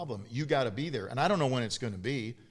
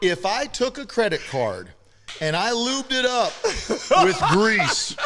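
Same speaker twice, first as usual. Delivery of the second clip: loud, high and laughing through it; cracking himself up while ribbing his friend; roasting his buddy's tight pants, loud and laughing; laughing rant; 7 semitones higher, 8 dB louder than usual. Real, not acted.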